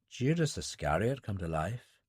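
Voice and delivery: Dylan murmurs to himself at the bar; murmured. A narrator's reading. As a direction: mutter it under your breath. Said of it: "Dylan murmured".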